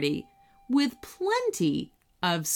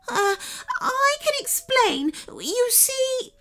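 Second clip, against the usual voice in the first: panicky. A narrator's reading. Sprite panicking.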